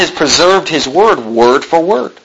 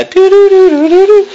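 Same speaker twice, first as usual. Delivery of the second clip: much higher and louder silly singsong; mocking doo-doo singsong; 4 dB louder than usual. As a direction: brush it off with a goofy tune.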